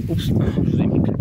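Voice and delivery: gust of wind blowing on the microphone; wind on mic. Real, not acted. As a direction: wind noise over the voice. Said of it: "désolé pour le vent". (vent dans le micro)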